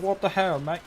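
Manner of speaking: high-pitched Aussie exclamation, played for laughs; mock Australian accent. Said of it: "People seem to think I'm Australian".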